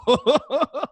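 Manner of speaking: high, loud burst of laughter; loud belly laugh. Real, not acted. Host cracking up over the terrible accents, belly laugh. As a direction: crack up hard.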